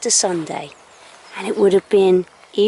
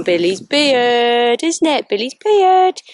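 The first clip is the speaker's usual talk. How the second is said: playful sing-song; 2 semitones higher, 5 dB louder, slower than usual. Real, not acted. Teasing the dog on camera.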